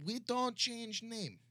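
slow, high German caricature; mock German accent. Party answers about its name, mock German accent. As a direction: draw it out in a stubborn German accent. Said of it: "Give me your best German accent".

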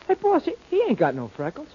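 high, excited surprise at the discovery; startled. Acted. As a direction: blurt it out, stunned.

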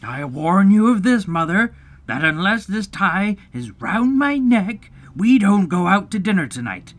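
emphatic, escalating ultimatum; stern. A narrator's reading. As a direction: warn like a melodramatic head of household. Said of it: "he went on sternly".

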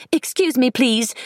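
polite excuse me. A narrator's reading. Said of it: "a smile and a polite".

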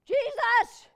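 high, loud cry to Jesus; sudden full-voiced yell; retold yell. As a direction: shout the name as an emergency plea. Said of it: "I just yell".

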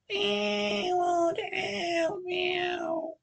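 high, whispery cat-woman mews; slow, sly, high mewing. Acted. Cat-woman teasing the officer from the bedroom, high mewing.

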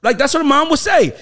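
rising, amused challenge; excited high pitch. Real, not acted.

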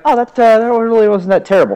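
high-pitched, quick relieved reaction; retold pleasant surprise. Picky eater retelling his first egg, mildly amazed. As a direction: act out finding it not so bad.

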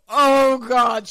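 shocked gasp. Retold or imitated. Shocked outburst retold.

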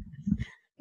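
laughing through it; giggling embarrassment; awkward laughing. Real, not acted. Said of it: "This is awkward".

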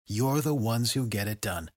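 ordinary delivery

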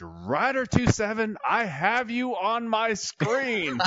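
high, very drawn-out accusing call; imitated irate controller. Controller confronting the pilots over radio, mock irate accusation. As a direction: stretch every word out, high and indignant.

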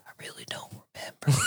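hushed, breathy voiceless whisper; mock whisper. Remembering the sandwich run, a sheepish whisper. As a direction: whisper it like hiding a guilty secret. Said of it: "I was sitting there like".

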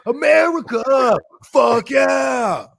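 belted America fuck yeah chant; mock anthem shout; shouted quote. Quoting Team America theme song.

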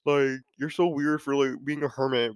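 high mocking critic voice; sneering imitation of a critic. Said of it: "some people might criticize you for saying that".

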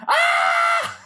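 very high, loud mock scream; imitated scream. Poor Lou at the premiere, just screaming. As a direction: shriek in hammed-up horror. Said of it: "Like, just screaming".

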